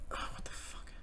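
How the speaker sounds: breathless whispered swear, caught off guard; shocked whisper. Retold or imitated. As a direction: hush it out as if you just saw something awful. Own shocked reaction retold.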